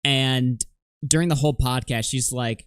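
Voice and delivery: usual speaking voice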